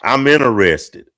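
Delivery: mock-bold pick-up line, pushed out loud; abrupt approach. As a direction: announce it bluntly to a stranger. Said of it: "I just walk up to a woman".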